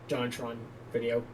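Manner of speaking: ordinary talk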